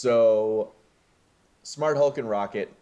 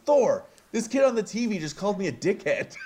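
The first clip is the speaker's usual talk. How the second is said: much higher, fast, giggling through it; Korg, laughing; 12 semitones higher, faster than usual. Retold or imitated. Podcaster voicing Korg complaining to Thor, laughing, high and fast. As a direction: tattle to Thor while laughing, quick and high. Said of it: "Korg cuts him off and says".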